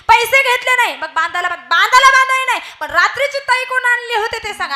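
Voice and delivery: husband bitten, yelling at his wife, loud and high; angry shouting. Acted. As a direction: yell at him in pain and fury. Acted quarrel continues.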